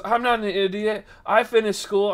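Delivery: mock indignant customer; imitated indignant retort. Imitating indignant lady in quarrel.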